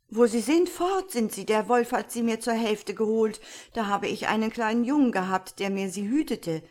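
loud, high-pitched agitated complaint; aggrieved man raising his voice; upset shepherd. A narrator's reading. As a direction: pour out the loss in agitation. Upset shepherd tells his loss.

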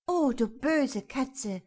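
slow, high, loud dismay; mother tutting at the cat; mother's reproach. A narrator's reading. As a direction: draw out a scandalised reproach. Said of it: "sagte die Mutter dazwischen".

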